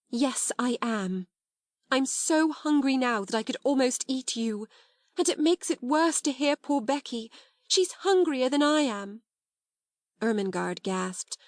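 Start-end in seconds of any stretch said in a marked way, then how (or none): 0.1-9.2 passionate confession. A narrator's reading. Sarah admitting hunger to Ermengarde, passionate. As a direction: let it burst out after holding back. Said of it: "in a new, passionate way".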